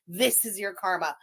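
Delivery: ordinary talk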